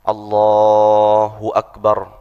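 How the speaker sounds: "Allahu Akbar" drawn out slowly; slow proper takbir. Shown as a demonstration. Teacher demonstrating takbir in full standing. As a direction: stretch "Allahu Akbar" fully. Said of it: "posisi sempurna".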